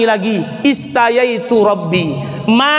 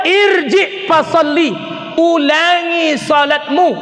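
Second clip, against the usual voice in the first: higher, slow firm command; stern Prophet; 6 semitones higher, slower than usual. Retold or imitated. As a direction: tell him again, slowly and firmly. Prophet orders again.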